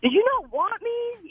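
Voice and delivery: put-on high, drawn-out child's question; imagined hurt daughter. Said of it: "she's gonna be like".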